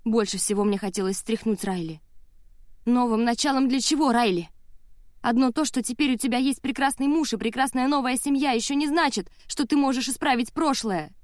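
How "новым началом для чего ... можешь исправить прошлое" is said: high-pitched, angry argument; indignant, cutting rebuttal; heated challenge. A narrator's reading. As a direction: argue back, voice raised in exasperation. Heated quarrel with sister.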